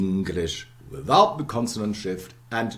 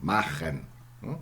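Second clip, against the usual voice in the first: ordinary talk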